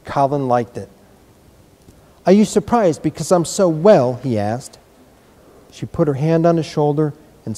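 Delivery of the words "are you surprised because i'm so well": put-on boy voice. A narrator's reading.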